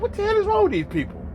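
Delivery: high, fast incredulous outburst. Retold or imitated. Man reacting to a video of two knuckleheads, incredulous. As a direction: exclaim it high and quick, in disbelief.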